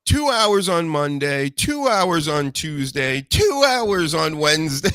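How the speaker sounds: two hours on Monday stressed slow and high; two hours on Monday said slowly, punching each two. Shown as a demonstration. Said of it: "Nobody wants three-hour shows".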